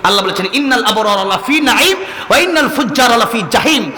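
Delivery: normal talking voice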